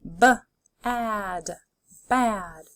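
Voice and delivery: d, ot, dot, parts pulled apart then joined; D, ot, dot: each part separate, then the whole word; segmented then blended. Shown as a demonstration. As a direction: sound it out and blend it. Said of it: "Let's keep going".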